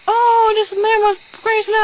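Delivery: high, tattling mock clerk voice; whiny clerk. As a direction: tattle to the boss.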